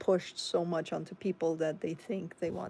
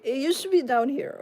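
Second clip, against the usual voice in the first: higher, louder push as she strains; strained exclamation; 7 semitones higher, 9 dB louder than usual. Real, not acted. Showing viewers an old finger trick, loud strained exclamation. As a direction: force it out mid-effort.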